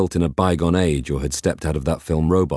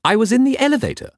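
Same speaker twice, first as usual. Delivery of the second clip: much higher, prim stuffy robot voice; a fussy butler robot; 3 dB louder than usual. Acted. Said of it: "replied the stuffy robot".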